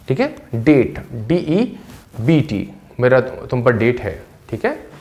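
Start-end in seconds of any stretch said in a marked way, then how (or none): 0.5-2.7 डेट then डीईबीटी, very slowly; slow pronunciation demo. Shown as a demonstration. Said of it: "डेट उच्चारण करेंगे". (धीमा उच्चारण demo)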